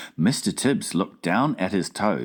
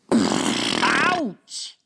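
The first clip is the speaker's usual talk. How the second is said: higher startled giant yelp; cried ouch; 11 semitones higher than usual. Acted. Giant burned by hot coffee, startled cry. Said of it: "he cried".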